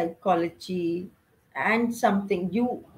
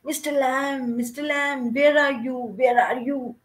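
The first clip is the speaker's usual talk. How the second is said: anxious calling; 4 semitones higher than usual. Retold or imitated. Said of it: "he started repeating his name".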